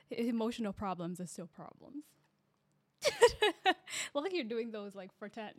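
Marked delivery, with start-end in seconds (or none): 3.0-4.1 high burst of laughter; loud laugh. Real, not acted.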